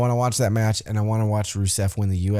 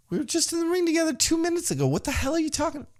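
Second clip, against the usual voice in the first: much higher, fast, baffled squeak; high mock Rusev voice; much faster than usual. Rusev baffled by Jinder's greeting, high squeaky mock voice. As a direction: squeak it out like a confused big man.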